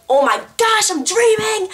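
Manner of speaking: high, loud panicked gasp of realization; startled to find it's a dream; alarmed realization. Retold or imitated.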